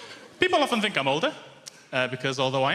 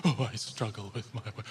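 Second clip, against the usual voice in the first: softer, lower mopey whine mocking sad people; a mock-sad sigh of a line; 5 semitones lower, 8 dB quieter than usual. Mocking people who say they struggle, softer and mopey. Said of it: "They're always very sad".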